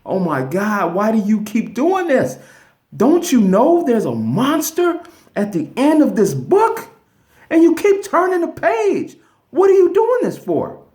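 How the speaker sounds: rising into a very high, loud puppet voice; exasperated Grover. Retold or imitated. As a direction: climb into a squeaky, outraged Muppet cry. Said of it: "there he is standing there like".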